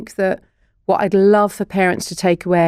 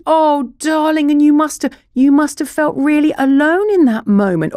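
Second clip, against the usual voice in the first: soothing parent; 7 semitones higher than usual. Shown as a demonstration. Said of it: "And to".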